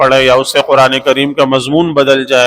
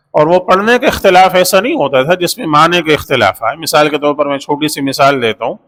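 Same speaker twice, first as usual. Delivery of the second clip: ordinary delivery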